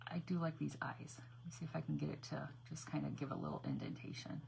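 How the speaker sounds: softly whispered while concentrating; hushed, focused murmur. Real, not acted. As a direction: whisper to yourself as you work. Concentrating on the craft.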